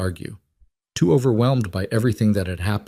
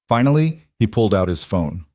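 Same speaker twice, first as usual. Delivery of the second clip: ordinary delivery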